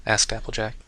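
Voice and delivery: usual speaking voice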